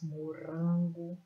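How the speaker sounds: "morango" with a loud, long "ran"; stress on RAN. Shown as a demonstration. Teacher showing the strongest syllable, "morango" stressed on "ran". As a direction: punch the middle syllable of "morango". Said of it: "a sílaba mais forte".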